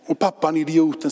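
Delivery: usual speaking voice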